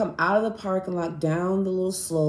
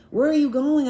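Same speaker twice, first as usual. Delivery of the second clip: louder and higher, calling out to someone walking away; calling after her; 8 semitones higher, 5 dB louder than usual. Retold or imitated. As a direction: raise your voice to reach her down the street. Calling after friend in rain.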